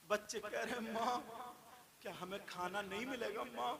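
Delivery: soft, low voice with long pauses; quiet, grave preacher's narration; hushed sermon storytelling. A narrator's reading. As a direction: drop right down for the tale. Tells of hardship in lowered voice.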